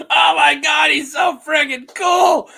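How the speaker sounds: high, loud gushing; excited squeal. Real, not acted. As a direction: gush over him at full volume. Fangirling over character.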